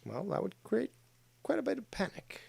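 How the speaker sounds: hushed conspiracy aside; conspiratorial whisper. Real, not acted. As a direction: let it drop to a secret whisper. Conspiratorial hint about media.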